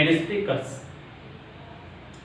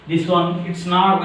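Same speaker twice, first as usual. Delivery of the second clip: ordinary delivery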